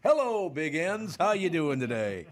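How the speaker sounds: loud, high doorstep hello; cheery milkman greeting. Acted. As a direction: call it out big like a friendly milkman. Acting the milkman greeting the family.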